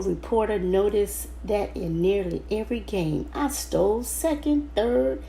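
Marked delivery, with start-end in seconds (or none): none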